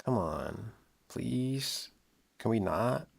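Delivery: ordinary delivery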